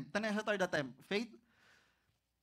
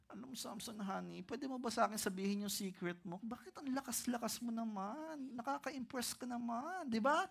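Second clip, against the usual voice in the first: soft, coaxing and much quieter, as if stroking his shoulders; sweet-talking Delilah; 2 semitones higher, 7 dB quieter than usual. Acted. Delilah sweet-talks Samson for his secret, softly coaxing. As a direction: purr the question to win his secret.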